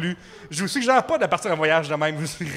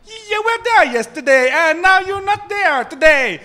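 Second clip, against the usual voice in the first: slow, loud, high exaggerated Italian accent in English; hammed-up Italian accent, high and drawn out; 10 semitones higher, 8 dB louder, slower than usual. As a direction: caricature an Italian host scolding on the phone. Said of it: "la fille parle avec cet accent-là".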